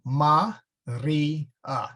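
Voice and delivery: "ma, ri, a" in three separate syllables. Shown as a demonstration. Teacher showing students Latin vowel splits, syllable by syllable. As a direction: split "ma, ri, a" with a pause after each vowel. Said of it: "Syllables split after the vowels".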